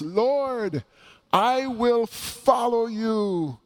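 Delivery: high, slow, eager cry from the crowd; exuberant voice. Acted. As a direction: call out "Lord, I will follow you" with eager zeal. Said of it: "an exuberant voice".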